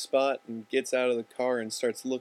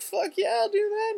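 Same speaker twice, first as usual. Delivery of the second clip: very high, squealing burst of eagerness; overexcited yes; 4 dB louder than usual. Retold or imitated. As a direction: shrieks his yes like a kid offered candy. Eager to sign weed petition.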